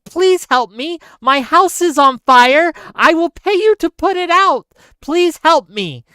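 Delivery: loud, high mock plea; pleading for help. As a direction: voice the desperate homeowner, loud and insistent. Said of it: "Keith is saying".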